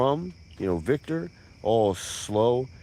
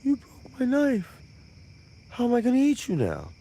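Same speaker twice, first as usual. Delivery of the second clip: much higher, a hint of a bawling child; whiny baby cry; 12 semitones higher than usual. Cannibal whining to the narrator about his broken knife, mock crying. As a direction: whine like a toddler who lost his toy, lightly done. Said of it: "He starts crying like a baby".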